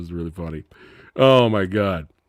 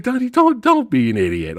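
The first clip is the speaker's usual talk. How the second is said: acted warning, pitched high then dropping; urgent warning; 13 semitones higher, 3 dB louder than usual. Pryor to Wilder among real inmates, urgent mock warning. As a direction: warn a naive friend off.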